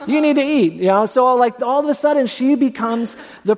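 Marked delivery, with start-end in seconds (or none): 0.1-0.7 fussing mother. Retold or imitated. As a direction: scold him to eat. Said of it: "he probably looks at Jesus and like".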